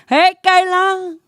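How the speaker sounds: slow, lightly voiced grandma mispronouncing her name. Grandma greeting her granddaughter by the wrong name, slow and fond. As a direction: drawl the wrong name like a sweet old grandmother. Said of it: "and she'll be like".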